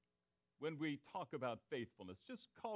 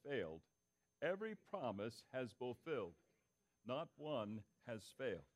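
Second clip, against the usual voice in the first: unhurried reading of scripture aloud; slow scripture reading; 5 semitones lower than usual. Shown as a demonstration. Pastor leading the church in reading Joshua, slow and measured. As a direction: read it slowly with the congregation, savouring it. Let's read this together.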